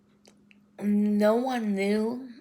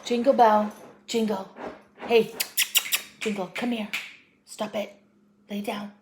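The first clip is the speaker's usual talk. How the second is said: raised, louder, short commands; calling a pet; 3 semitones higher, 3 dB louder than usual. Real, not acted. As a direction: call sharply to get the dog's attention.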